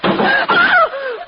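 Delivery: terrified cry. Acted. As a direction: cry out in sudden terror. Wife spots the mad woman.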